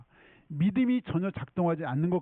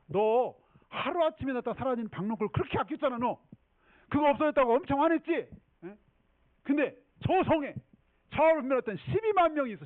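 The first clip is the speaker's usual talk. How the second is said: high, emphatic acted scolding; heated pleading rebuke; impassioned remonstrance; 8 semitones higher, 3 dB louder than usual. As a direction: press the argument home, voice raised high. Voicing God arguing with Jonah.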